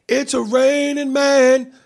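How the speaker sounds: high, drawn-out flamboyant cry. Acted. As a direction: belt it out campily, high and loud. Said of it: "stereotypical, flamboyant".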